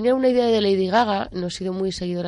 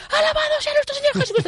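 exclamation of praise; faster than usual. Retold or imitated. Exclaiming praise after confession wish.

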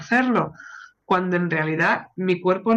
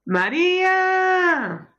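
much higher, long drawn-out yell of her name; shouting down the hall; 10 semitones higher, much slower than usual. Acted. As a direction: holler her name down the corridor so she hears. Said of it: "grítale por el pasillo".

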